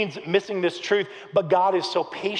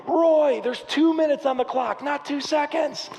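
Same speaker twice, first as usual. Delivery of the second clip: high-pitched teammates' protest; mock outrage, slowing on the last words; retold exasperated scolding; 9 semitones higher, slower than usual. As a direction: imitate incredulous teammates calling him out. Teammates scold him in story.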